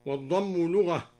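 ordinary delivery

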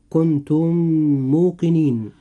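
meem of كنتم merged into موقنين; idgham of the two meems. Shown as a demonstration. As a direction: hold one doubled m across كنتم موقنين. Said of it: "إدغام مثلين صغير".

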